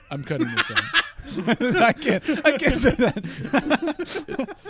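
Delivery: breaking into helpless laughter; laughing through the words, slow. Real, not acted. Laughs at his own offensive joke.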